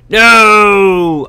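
high, drawn-out loud "no"; loud cry of no. Real, not acted. Player nearly losing iron, loud dismayed cry.

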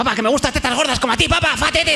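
high, fast, excited burst; mock blurt. Son imagining blurting out to his sick father, overexcited.

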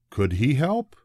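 ordinary delivery

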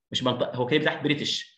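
ordinary talk

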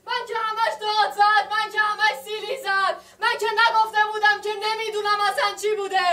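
anguished outburst. Acted. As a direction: let the grievances spill out all at once.

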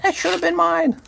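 high, whiny mock complaint; squeaky jealous grumble; jealous Rand. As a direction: pitch it up like a sore loser. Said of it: "Rand's gonna be like".

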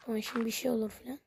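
ordinary talk

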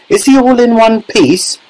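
high-pitched worried question; anxious cry. A narrator's reading. Mother asks about her son, anxious high cry. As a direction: cry it out high, fearing the answer. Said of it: "cried Missus T V".

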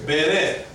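"Bere" as be-RE, weight on the last syllable; stress on second syllable. Shown as a demonstration. Teacher confirms the accent's place, "Bere" stressed at the end. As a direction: hit the final syllable of "Bere". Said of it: "On the second syllable".

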